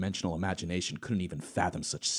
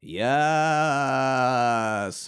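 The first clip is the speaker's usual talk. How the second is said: higher, louder drawn-out machine "Yes"; excited robot yes; 6 semitones higher, 7 dB louder than usual. Acted. Hawking answering Epstein through his machine, eager and loud. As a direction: stretch it like eager text-to-speech. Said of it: "typed excitedly into the voice machine".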